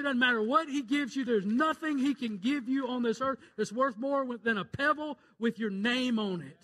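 loud, high pulpit climax; shouting preacher proclaiming heaven's worth; full-force preaching. Acted. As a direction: drive it home to the congregation.

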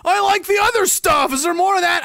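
high, wailing plea; mock dismayed viewer. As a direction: plead like a hammed-up disappointed fan. Voices the dismayed viewer pleading.

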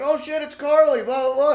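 high, loud mocking friends' reaction; hammed-up surprise from the friends; loud mock gossip voice; mock excited exclaim. Friends' text retold.

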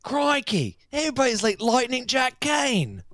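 high, loud, exaggerated Aussie cry; mock Australian accent. Co-host parodying childhood Australian films, high hammed-up Australian accent. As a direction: yelp it in cartoonish Australian. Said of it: "the only Australian accent".

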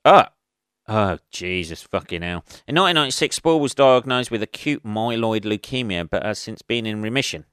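usual speaking voice

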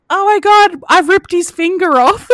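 high, loud cry of alarm; reenacted shout for help, pitch way up; retold panicked yell. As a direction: yell it out, half laughing. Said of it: "So I yell out".

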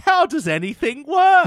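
high, loud, bewildered squeak. Retold or imitated. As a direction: play her totally baffled. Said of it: "which is like".

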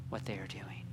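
breathless whisper fading out; dying whisper. Acted. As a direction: let the prayer die into a whisper. Jesus praying on the cross.

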